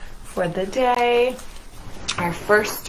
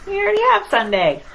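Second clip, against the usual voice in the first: sheepish realization; 9 semitones higher, 3 dB louder, much faster than usual. Acted. Sun realizes its mistake.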